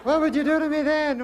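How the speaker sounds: put-on high, coy dating-show girl; girly contestant voice. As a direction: put on a high giggly girl's voice. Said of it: "Girl gotta go".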